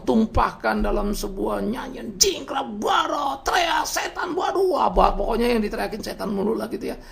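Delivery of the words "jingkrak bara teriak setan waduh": high pitched mock shouts of frenzy; lightly voiced frenzied shouting; mock worship shouting. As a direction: act out wild shouting in worship, half in jest. Mimicking wild worship outbursts.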